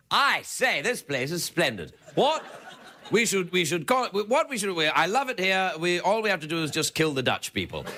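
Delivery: a hammed-up upper-class Englishman, pitch jumping; mock posh British. Comic sketching the British arriving in South Africa, mock posh British. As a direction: play the pompous colonial Brit.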